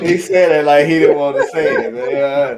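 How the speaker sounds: amused, loud ribbing; laughing tease. Real, not acted. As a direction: call him out through laughter. Teasing co-host's mumbled rankings, laughing.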